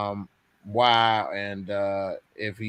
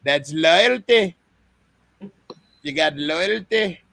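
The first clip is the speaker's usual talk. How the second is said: mock repeat of the father's line; 5 semitones higher, 3 dB louder than usual. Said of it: "how you said it?".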